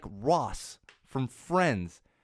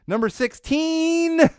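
drawn-out announcer callout; 9 dB louder, much slower than usual. Acted. Countdown host calling out number.

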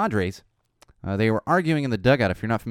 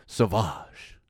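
sultry perfume ad. Retold or imitated. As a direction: purr the brand name like a cologne ad. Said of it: "Johnny Depp, commercials for".

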